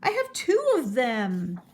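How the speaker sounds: yelling with a laugh, eager to answer; excited yell. Acted. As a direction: blurt it out in proud glee. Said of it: "and Morris yelled".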